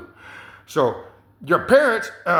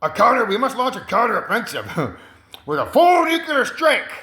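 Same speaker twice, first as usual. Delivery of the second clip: urgent military adviser; 6 semitones higher than usual. Retold or imitated. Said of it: "He's like".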